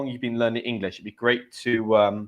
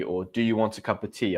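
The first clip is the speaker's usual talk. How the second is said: normal talking voice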